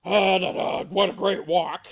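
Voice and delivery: lightly voiced hearty father bear; a hint of a loud, pleased bear; Papa Bear. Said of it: "Papa Bear".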